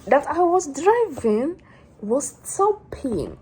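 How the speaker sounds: slow, loud, high stretched-out words; dramatic emphasis. Real, not acted. Vlogger restarting her breakdown story, slow dramatic emphasis. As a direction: draw each word out high and loud.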